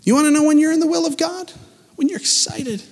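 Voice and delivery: fervent, lifted sermon voice over music; preaching. Acted. Pastor preaching to the congregation, full fervor. Preaching, high fast question.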